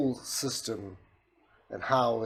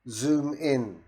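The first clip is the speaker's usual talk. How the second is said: higher, louder order to a machine; voice command; 4 semitones higher, 5 dB louder than usual. Shown as a demonstration. Instructing the system to zoom, raised voice command. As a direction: project it for the software to hear.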